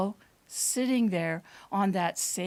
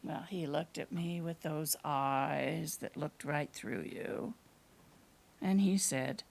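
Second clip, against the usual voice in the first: plain reading voice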